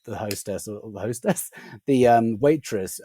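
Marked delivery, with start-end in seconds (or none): none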